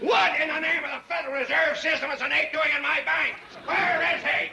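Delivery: high-pitched, blustering shout; outraged yell. Acted. Bank president finding an ape in his bank, yelling in outrage. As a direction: yell your indignation, pitch way up. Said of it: "Please don't yell so loud".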